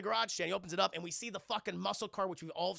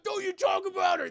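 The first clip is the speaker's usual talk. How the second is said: angry shout; 12 semitones higher, 9 dB louder than usual. Acted. Brian defends Mia, starts fight.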